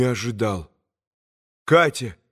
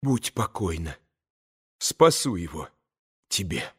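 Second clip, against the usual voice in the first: lower, soft, hushed reply; quick whisper; 8 semitones lower, 6 dB quieter than usual. A narrator's reading. Katya quietly promises Grushenka to save him, whispering. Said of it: "быстро прошептала Катя".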